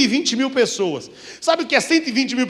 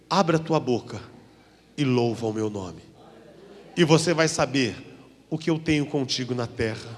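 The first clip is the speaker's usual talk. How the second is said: voice of God; 9 semitones lower, 6 dB quieter than usual. Retold or imitated. God tells him to sing, a lightly voiced low solemn command. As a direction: lower and softer, a solemn divine voice. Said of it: "eu ouvi aquela voz dizendo".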